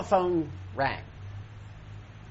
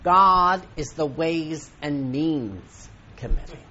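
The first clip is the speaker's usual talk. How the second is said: louder, ringing pulpit proclamation; preaching; 2 semitones higher, 13 dB louder, slower than usual. Acted. Preacher booming to the congregation about God's committee. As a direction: preach it out to the room.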